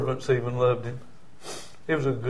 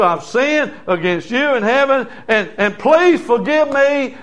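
lightly acted plea for forgiveness, a little raised; earnest preacher voicing a penitent son; pleading son; 6 semitones higher, 3 dB louder than usual. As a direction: beg a father to take you back. Acts out prodigal son pleading forgiveness.

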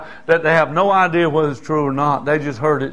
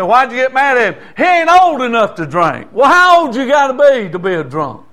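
higher, louder pointed questions; indignant challenge; 7 semitones higher, 4 dB louder than usual. Retold or imitated. Preacher confronting drinking parents, indignant challenge. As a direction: throw it back at the hypocrites.

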